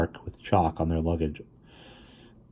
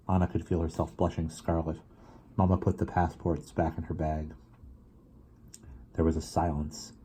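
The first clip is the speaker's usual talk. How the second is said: ordinary delivery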